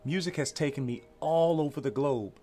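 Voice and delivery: ordinary delivery, nothing marked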